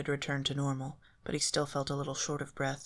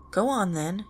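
louder defiant dare; daring; 6 semitones higher, 6 dB louder than usual. A narrator's reading. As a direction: challenge the shadow to move. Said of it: "daring it to move again".

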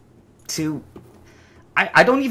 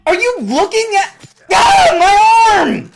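much higher and louder, pained shriek; terrified pained yelling; panicked screaming; 10 dB louder than usual. Real, not acted. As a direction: yell out as it bites your arm. Creature bit his arm.

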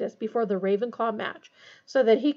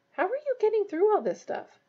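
high, amazed asking; amazed question; 11 semitones higher than usual. Acted. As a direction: marvel at the workload. Harry asking, voiced higher.

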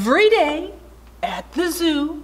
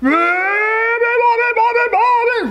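roared; 11 semitones higher, 5 dB louder than usual. A narrator's reading. Lion wakes the sleeping bird, roaring. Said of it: "roared the lion".

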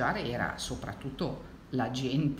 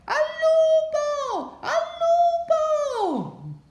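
long, high alarm call; stretched cry for help; cry of wolf; 9 dB louder than usual. A narrator's reading. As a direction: yell it as if the wolf is really there. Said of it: "gridando sempre".

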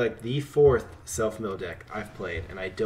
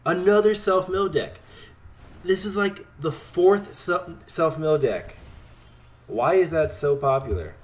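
higher and louder, fed-up complaint; exasperated rant; 7 semitones higher, 4 dB louder than usual. Real, not acted. Gamer ranting at opponent's self-mill deck, exasperated and loud. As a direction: vent about yet another self-mill deck.

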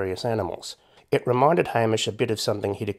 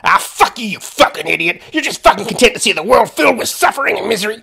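loud, high, raving American rant; furious yelling; 12 semitones higher, 10 dB louder than usual. Acted. Raving man cursing Hamish on his doorstep, furious yell. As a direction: scream abuse like a raving lunatic.

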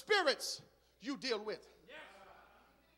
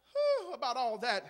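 ordinary talk, nothing marked

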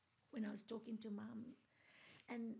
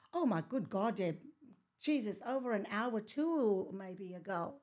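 loud, alarmed exclamation; 10 dB louder than usual. Retold or imitated. Mum on the phone, alarmed and loud about Boris. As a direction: burst out in alarm.

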